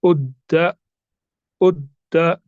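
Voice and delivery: उद्दा with the द्द pressed hard; emphasized doubled letter. Shown as a demonstration. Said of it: "emphasise करेंगे".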